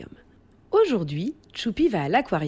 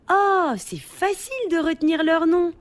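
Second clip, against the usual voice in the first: put-on high-pitched little child voice; childlike, high and delighted; 8 semitones higher than usual. A narrator's reading. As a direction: chirp it like a pleased youngster. Choupi reacts to zebra fish name.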